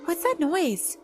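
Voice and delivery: high, frightened question; scared voice. Acted. Charlotte hears spooky noises next door, high scared voice. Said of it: "said Charlotte in a scared voice".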